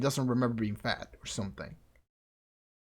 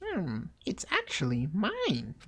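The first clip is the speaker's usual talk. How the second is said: slow, higher, swooping voice; coy character voice; 6 semitones higher, slower than usual. Acted. Character claims the voice as theirs, slow and coy. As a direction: draw it out in a put-on voice.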